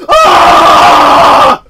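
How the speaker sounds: full-throated scream of a man waking in agony; agonized scream. Retold or imitated. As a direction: scream it out in raw pain, as loud as you can. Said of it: "Yeah, like that".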